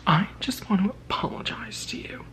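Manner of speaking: whispered apology; secretary whispering sorry in a side room. Retold or imitated. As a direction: quietly confide an apology.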